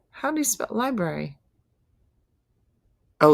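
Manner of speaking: ordinary talk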